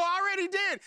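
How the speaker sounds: very high-pitched yelled comeback; frustrated protest. Retold or imitated. Son answers dad's order, high frustrated yell. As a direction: imitate a panicked learner yelling back. Son yells back, retold.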